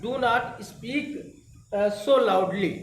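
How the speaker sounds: very slow, word by word; slow dictation. Shown as a demonstration. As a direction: dictate it twice for the class. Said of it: "तो".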